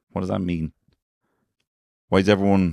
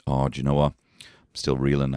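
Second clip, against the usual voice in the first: ordinary delivery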